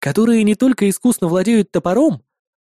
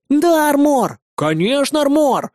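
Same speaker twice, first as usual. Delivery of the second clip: put-on high-pitched eager children; children's cries; 7 semitones higher, slower than usual. A narrator's reading. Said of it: "Тут же понеслись крики". (восторженные детские возгласы)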